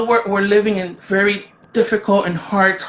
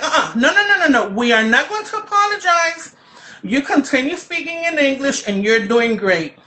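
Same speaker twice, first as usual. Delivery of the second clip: high-pitched insistent protest, re-enacted; loud objection; 7 semitones higher than usual. Mother calling out to the apologizing Guard speaker, loud high objection. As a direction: call out high, refusing the apology, then ease down. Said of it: "you know, loud tone, tone of voice".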